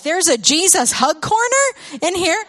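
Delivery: high, slow, wondering announcement; imitating delighted children; excited kids. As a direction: marvel like little kids finding a secret. Kids discover hug corner.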